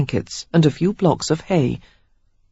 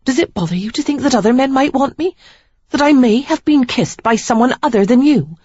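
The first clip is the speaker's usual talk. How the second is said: higher, louder, faster taunt; Sarah provoking her jealous fiancé, higher and louder; 8 semitones higher, 4 dB louder than usual. A narrator's reading. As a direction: goad him, quick and high.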